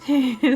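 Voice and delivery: a burst of laughter; brief giggle at a memory; short laugh. Acted. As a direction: laugh out before apologising. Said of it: "Are you laughing?".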